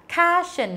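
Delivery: "cushion" said as "kah-shun", open a; mispronounced cushion. Shown as a demonstration. Teacher imitating other learners, "cushion" as "kah-shun". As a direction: say "cushion" wrongly with a long ah. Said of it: "có người đọc là cushion này".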